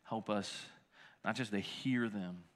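low, hushed prayer voice; quiet prayer. Acted. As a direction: pray softly and reverently. Closing prayer.

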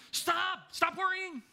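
high-pitched yelped command; mock exasperated yell. Mock parent to anxious kid, exasperated yell. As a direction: order the worry away in mock exasperation.

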